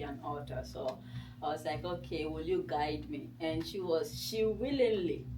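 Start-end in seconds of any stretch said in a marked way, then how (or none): none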